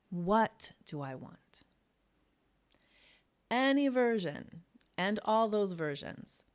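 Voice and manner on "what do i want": slow whisper with "What" stressed; hushed question with "What" carrying the weight. Shown as a demonstration. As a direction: breathe it out slowly, "What" leaned on. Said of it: "with the emphasis on different words".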